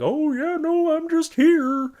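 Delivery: high, slow and loud silly voice; goofy high Barney guy. Retold or imitated. Barney guy answering the party host, high and slow. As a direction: squeak it out, slow and innocent.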